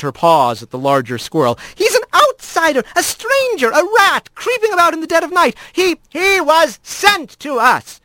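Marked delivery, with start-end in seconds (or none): 1.8-5.6 high-pitched, scolding magistrate squirrel; exasperated accuser in a raised pitch; indignant magistrate. Acted. Voices an angry magistrate in story. 5.7-8.0 high, slow, emphatic call; shouted interjection. Acted. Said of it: "a voice shouted".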